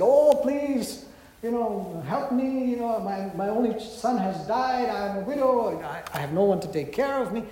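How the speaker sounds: hammed-up plea, starting high on "Oh, please"; imagined pleading widow. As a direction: beg for help as an imagined widow.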